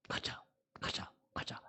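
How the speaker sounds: imitated pleading in a near whisper, slow; hushed coaxing. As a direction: coax a friend very softly. 친구를 조르는 장면 재연. (속삭이듯 조르는 흉내)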